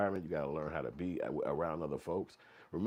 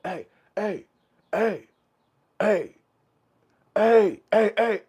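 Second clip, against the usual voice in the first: high repeated holler, building louder; yelling through vents; 14 semitones higher, 15 dB louder, slower than usual. Acted. Inmates pestering you through the vents, insistent high yells. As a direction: keep calling out sharply, each one louder. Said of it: "people yelling to you through the vents".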